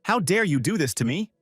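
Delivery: angry outburst. A narrator's reading. Number Ten bursts in on the girls, angry and indignant. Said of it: "angrily exclaimed".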